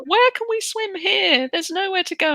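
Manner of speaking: lightly voiced whining kids. Kids complaining about no lake.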